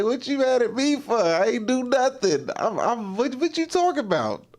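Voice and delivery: high, defensive mock protest; imitating friend's defensive whine. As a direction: play the friend who insists he did nothing wrong. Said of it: "He's like".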